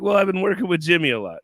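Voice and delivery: quick, lightly Scottish-tinged reply; hint of Scottish. Answering someone who heard Scottish, faint Scottish lilt. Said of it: "I was like".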